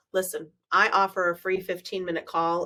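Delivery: usual speaking voice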